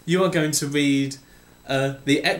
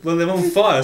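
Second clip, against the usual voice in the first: ordinary talk, nothing marked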